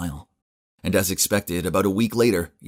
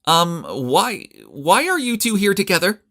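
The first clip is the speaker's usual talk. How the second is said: flustered, much higher and louder; startled; 13 semitones higher, 5 dB louder, slower than usual. A narrator's reading. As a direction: caught off guard, stammering. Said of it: "almost fell off her chair in surprise".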